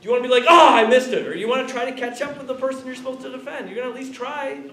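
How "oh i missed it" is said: loud, high, hammed-up groan of defeat; mock dismay. Teacher mimics a beaten defender, loud mock dismay. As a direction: cry out like a player who gave up.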